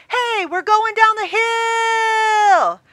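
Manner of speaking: slow, high, loud drawn-out shout; yelling to a friend. Retold or imitated. Rider shouting to a friend mid-drop. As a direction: holler across to your friend. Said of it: "Nobody's looking at their friend yelling".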